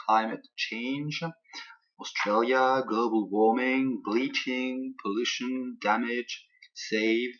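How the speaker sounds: "Climate change", "Australia", "endangered" each punched on the stressed syllable; emphatic model pronunciation of "global warming", "species", "human beings", word by word; stressed keywords. Shown as a demonstration. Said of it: "j'accentue correctement les mots-clés".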